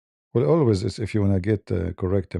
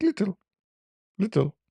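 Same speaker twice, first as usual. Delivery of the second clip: squeaked up high, tiny; high little voice; 10 semitones higher than usual. Retold or imitated. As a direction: make it small and squeaky. His squeaky tiny reply.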